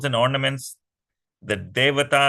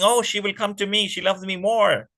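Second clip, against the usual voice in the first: fast, higher and eager; eager demons; 7 semitones higher than usual. Acted. The demons wait eagerly for Mohini, fast excited voice. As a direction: rush it with greedy hope.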